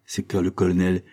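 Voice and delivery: usual speaking voice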